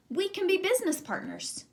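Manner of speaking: bright high eager pitch; eager Hare. Acted. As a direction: sell the deal with a high, eager voice. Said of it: "Hare said".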